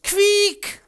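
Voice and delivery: sehr hohes, schrilles Quieken; Schweinequieken. Acted. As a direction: quiek wie ein Schwein beim Schlachten. Schwein im Schlachthof nachgemacht. (Schwein quiekt laut)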